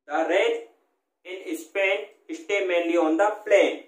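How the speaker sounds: in Spain stay mainly on the plain, very slowly, word by word; slow dictation. Shown as a demonstration. Teacher reads the example sentence slowly for students. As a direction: read it like dictation to a class. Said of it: "यानी rain, Spain".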